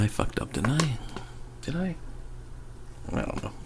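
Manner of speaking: a hushed aside, like admitting guilt to yourself; guilty whisper. Real, not acted. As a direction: confess under your breath.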